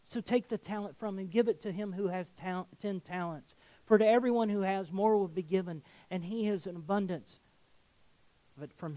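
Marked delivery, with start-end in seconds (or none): 0.1-7.4 loud, rising sermon proclamation; preaching at full force. Acted. Preacher proclaiming scripture to the church, in a loud, forceful voice. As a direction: thunder the verdict at the congregation. Preacher reads the verdict aloud.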